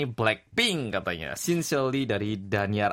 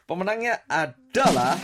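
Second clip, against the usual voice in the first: hyped giveaway announcement; 7 semitones higher, 5 dB louder than usual. Acted. Building suspense before winner.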